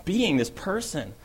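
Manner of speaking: ordinary talk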